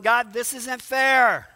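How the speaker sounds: loud, slow cry of complaint to God; lamenting. Acted. Psalmist complaining to God in prayer, loud lament. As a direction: protest to God, stretching the words. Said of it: "Fifty of them are laments".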